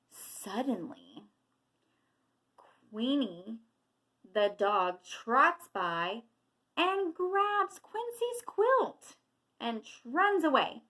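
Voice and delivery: pause, then heighten the grab; dramatic storytelling. A narrator's reading. Teacher reading of dog grabbing the quilt, dramatic build.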